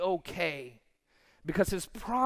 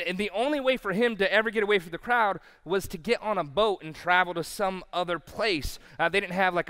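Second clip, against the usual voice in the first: ordinary talk, nothing marked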